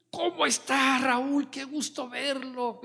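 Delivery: high, beaming mock greeting from a happy coworker; cheerful greeting. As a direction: play the overjoyed payday clerk, sing-song and high.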